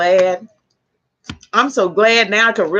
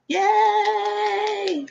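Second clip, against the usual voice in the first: excited cheer; 10 semitones higher, 4 dB quieter than usual. Real, not acted. Viewers confirm they can hear.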